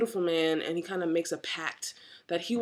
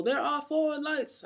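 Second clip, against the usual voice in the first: slow, high, mock-dramatic Star Trek line; lightly hammed-up TV captain; dramatic Picard quote; 9 semitones higher, slower than usual. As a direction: intone it slowly like a stern starship captain. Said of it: "and the whole".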